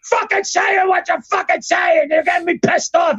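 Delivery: high-pitched irate boss outburst; imitated angry yelling. Co-host retelling the boss yelling at an announcer, mock yell. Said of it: "yelling at him".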